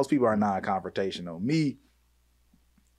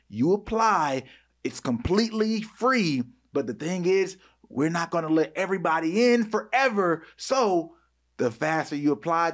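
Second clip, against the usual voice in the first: higher-pitched, emphatic sales pitch; promo ad read; 7 semitones higher than usual. Acted. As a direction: pitch it straight to the listener, stretching key phrases. Reads a promo for their network.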